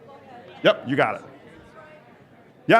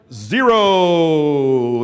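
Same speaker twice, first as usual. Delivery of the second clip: louder, long stretched zero; held final count; 6 dB louder than usual. Real, not acted. Coach calling the final time to walkers, loud and held. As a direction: hold the last number out. Coach ends last interval.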